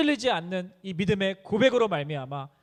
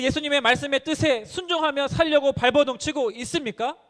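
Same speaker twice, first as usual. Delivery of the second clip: higher and louder, driving questions; full-force preaching; 7 semitones higher, 6 dB louder than usual. Acted. Preacher challenging the congregation, rising and loud. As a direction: press each question on the crowd.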